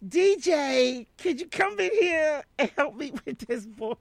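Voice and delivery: high, loud call across the room; hammed-up summons, pitched high; imitated calling out. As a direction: holler for help as an older colleague would. Imitates Jean calling for help.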